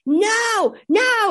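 put-on high shouted child's no; child's scream. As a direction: screech it like a tantrum kid. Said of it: "he's screaming the whole thing".

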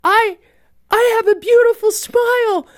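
put-on high childlike voice, eager; little boy. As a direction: play the delighted blind boy. Blind boy quoted, voiced high.

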